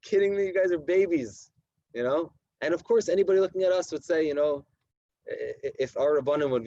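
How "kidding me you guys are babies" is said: a touch higher, scoffing; lightly voiced incredulity; mock disbelief. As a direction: laugh off the boys' worry. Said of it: "I was like".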